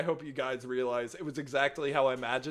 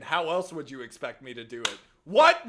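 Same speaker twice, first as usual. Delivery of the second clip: ordinary talk, nothing marked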